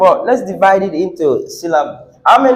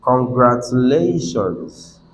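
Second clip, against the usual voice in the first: slow model pronunciation; 6 semitones lower, 4 dB quieter, slower than usual. Shown as a demonstration. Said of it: "I'm just introducing this".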